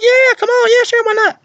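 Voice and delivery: very high, excited mock squeal; imitated gushing high-pitched yes; over-eager agreement. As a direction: say yes like you are wildly hyped. Acted over-eager yes to photo request.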